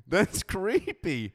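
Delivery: high, slow laughing drawl. Real, not acted. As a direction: say it still laughing. Laughing after joke.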